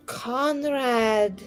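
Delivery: ordinary talk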